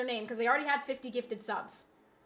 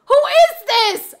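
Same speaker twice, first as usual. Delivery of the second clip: high, loud cry of disbelief; high stunned yelling; disbelieving shout; 12 semitones higher, 14 dB louder than usual. Real, not acted. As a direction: yell it out, floored by the surprise. Again yells at the mystery.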